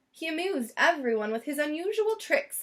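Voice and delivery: plain reading voice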